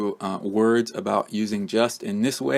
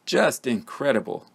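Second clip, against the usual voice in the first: just and incredible both stressed; JUST incredible, both words weighted; 3 semitones higher than usual. Shown as a demonstration. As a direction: land on just, then incredible. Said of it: "we stress the word just along with the adjective".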